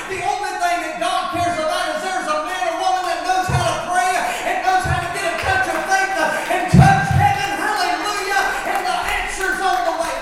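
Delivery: loud, fast, rising preacher's shout; full-force preaching. Acted. Pastor firing up the congregation about prayer, shouting fast. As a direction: preach it hard and fast to the rafters.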